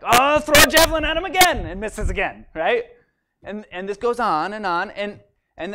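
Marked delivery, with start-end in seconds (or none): 0.0-1.7 much higher and louder, a furious outburst; raging king. Retold or imitated. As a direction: hurl it out like a mad king. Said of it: "and he's like".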